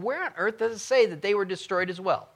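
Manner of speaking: mildly exasperated question to yourself; retold puzzlement. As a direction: replay your own baffled searching. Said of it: "and I'm going".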